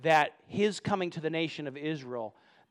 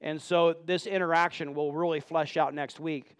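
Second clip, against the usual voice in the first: ordinary delivery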